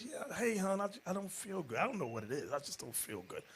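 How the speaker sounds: soft, weak mock moan of a sick wife; wife in bed playing sick, soft and weak; feeble sick wife. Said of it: "She says".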